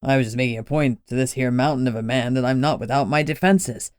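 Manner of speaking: put-on curious, easy-going old man explaining; a wily older man, unbothered. Lenny continues.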